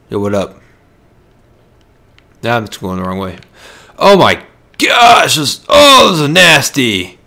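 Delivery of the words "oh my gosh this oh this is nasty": disgusted outcry. Real, not acted. Gamer recoils at a filthy game bathroom, loud and high.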